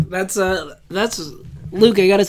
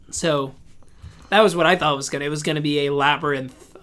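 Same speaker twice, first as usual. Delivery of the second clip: ordinary delivery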